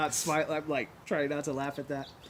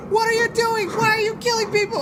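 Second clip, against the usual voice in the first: high, loud outraged cry; horrified mock shout; 11 dB louder than usual. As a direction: yell in alarm at a teammate. Horrified teammate confronts the killers.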